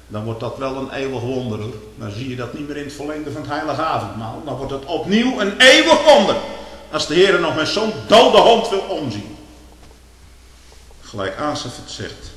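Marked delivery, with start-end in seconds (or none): 4.5-9.4 loud and high; preacher marvelling at grace, loud and high; exultant exclamation. Acted. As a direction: proclaim the wonder with fervor. Preacher exclaims eternal wonder.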